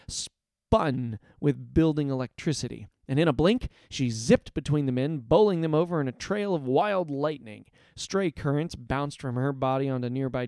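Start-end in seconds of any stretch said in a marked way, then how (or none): none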